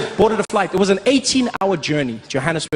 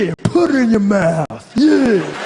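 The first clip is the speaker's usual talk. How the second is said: mock American swagger; 3 semitones higher, slower than usual.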